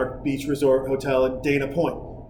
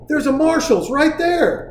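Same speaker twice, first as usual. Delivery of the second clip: put-on high, slow, thrilled little girl voice; a kid proudly pointing out a store; 9 semitones higher, slower than usual. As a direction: daughter at the window, delighted, in a high slow voice. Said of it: "She goes".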